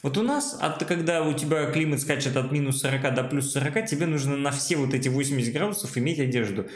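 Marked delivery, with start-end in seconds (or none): none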